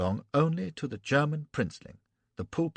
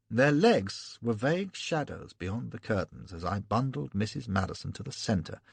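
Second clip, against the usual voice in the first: ordinary delivery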